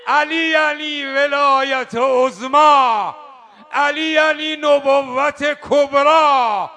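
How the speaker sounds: preaching at full force. Acted. Preacher chanting Ali's titles to the crowd, slow and loud. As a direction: proclaim each title of Ali like a rally cry.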